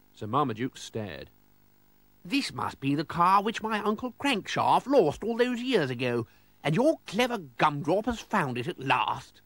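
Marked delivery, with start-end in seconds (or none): 2.2-9.4 high, theatrical lordly amazement; worked-up excitement. Acted. Lord seeing his uncle's long-lost car, high excited voice. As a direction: exclaim it as an overexcited aristocrat. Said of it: "He calmed down a bit".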